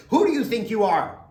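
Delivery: loud, high, frustrated yelling; frustrated shout. Retold or imitated. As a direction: shout it at the calm man. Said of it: "you say".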